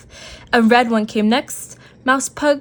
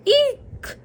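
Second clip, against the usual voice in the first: very high, shrill-sounding eek; a tiny mouse's fright squeak; startled squeak. A narrator's reading. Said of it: "squeaked Mouse".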